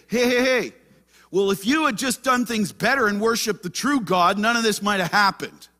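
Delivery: loud, high, pointed-finger comeback; mock defensive retort. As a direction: snap back as the blaming prophet would. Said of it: "doesn't get defensive and say".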